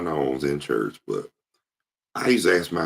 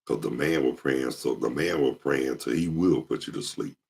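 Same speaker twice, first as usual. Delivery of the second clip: ordinary delivery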